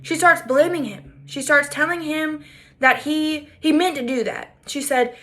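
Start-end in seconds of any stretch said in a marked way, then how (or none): none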